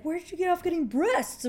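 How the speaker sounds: high-pitched mock indignation; upset disbelief. Retelling the boy's shock at her reveal, upset and incredulous. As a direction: act out an outraged, baffled question. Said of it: "he's really upset".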